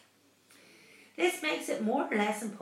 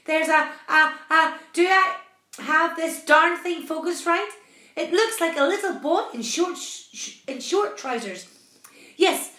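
loud, high, agitated captain; astonished cry; 7 semitones higher, 11 dB louder than usual. A narrator's reading. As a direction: stammer in disbelief while shouting out the sighting. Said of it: "he cried".

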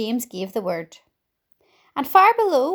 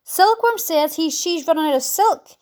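high, raised-voice report; yelling; 5 semitones higher, 4 dB louder than usual. A narrator's reading. Centipede warning James about the silk, yelling. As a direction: call urgently up from below. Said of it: "yelled the centipede from below".

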